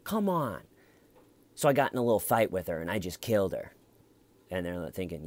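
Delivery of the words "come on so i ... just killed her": higher, mocking disbelief, rolling her eyes at the accusation; sarcastic confession. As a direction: act out a sarcastic fake confession. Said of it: "sarcastic confession thing".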